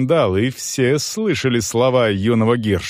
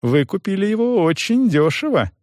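high-pitched, wondering tone; sincere surprise; 10 semitones higher than usual. A narrator's reading. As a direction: marvel at the bargain, voice raised in pitch. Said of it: "искренне удивился Крутец".

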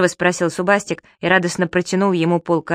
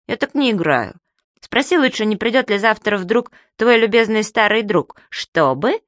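higher, lively little creature voice; pouty, cheeky creature whining; Subastik's voice; 5 semitones higher than usual. A narrator's reading. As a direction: play the cheeky magic creature.

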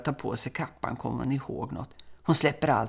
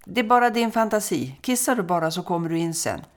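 louder, higher call back, a touch impatient; mother calling back; 5 semitones higher, 4 dB louder than usual. Acted. Busy mother calling back to her son outside, louder and higher, mildly impatient. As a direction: call back from the kitchen, brushing it off. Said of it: "Mamman suckar och ropar tillbaka".

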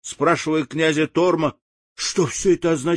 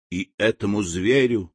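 ordinary delivery, nothing marked

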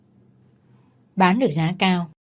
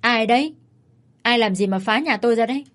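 calling out; 3 semitones higher than usual. A narrator's reading. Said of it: "giọng chị Soan ... vang lên".

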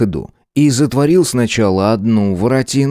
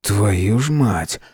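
slow exasperated curse; much slower than usual. Acted. Narrator swears again, exasperated.

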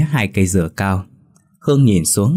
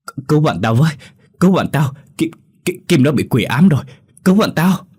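panicked breathless plea; 7 semitones higher, 3 dB louder than usual. A narrator's reading. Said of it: "vừa nói vừa thở gấp gáp".

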